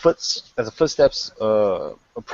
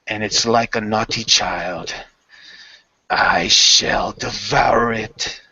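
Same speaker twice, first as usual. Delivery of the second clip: menacing mistress. Acted. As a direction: savor devour like a predator. Mistress threatens.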